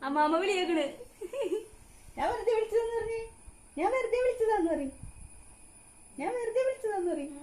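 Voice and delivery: high sing-song coaxing of a little one; baby talk. Acted. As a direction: coax the baby, high and bright. Mother coaxing baby to call. (കുഞ്ഞിനോട് കൊഞ്ചി സംസാരിക്കുന്നു)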